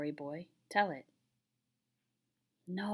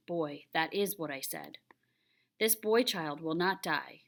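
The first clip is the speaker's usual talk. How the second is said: ordinary delivery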